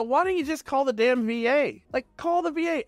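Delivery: half-mocking "just call the V A"; telling a buddy the obvious fix, exasperated; exasperated retelling. Retold exasperated suggestion to friend.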